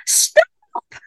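very high, loud shriek; shouted. A narrator's reading. Camper yelling at the bear eating the cake, shouted. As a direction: shout it out impulsively, pitched right up. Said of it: "I shouted".

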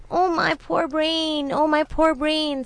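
slow, high-pitched lament; jackal's mock wail. A narrator's reading. Jackal playing dumb before the tiger, a slow wail. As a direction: draw it out in put-on distress. Jackal feigns muddled distress.

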